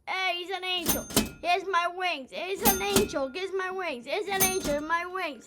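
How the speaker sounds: high, loud mocking taunt; mock bar owner yell. Barkeep Nick jeers at the ejected pair, high and loud. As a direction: jeer at them as they're thrown out. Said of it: "the guy Nick at the bar goes".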